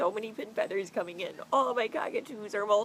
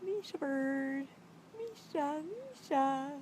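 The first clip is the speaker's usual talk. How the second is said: coaxing pet voice; 7 semitones higher, 5 dB quieter, much slower than usual. Real, not acted. Slow, high coaxing to parrot.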